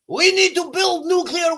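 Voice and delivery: botched mock Iranian accent; failed Iranian accent. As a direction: try an Iranian accent and fall short of it. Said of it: "I can't do an Iranian accent".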